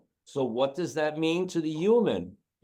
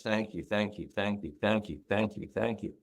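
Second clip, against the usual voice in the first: soft repeated thanks; 5 semitones lower, 7 dB quieter, faster than usual. Retold or imitated. Dog's repeated imagined thanks.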